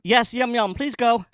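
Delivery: high, loud, brisk brush-off; impatient reply. Acted. Departing crew to chatty parking control, impatient.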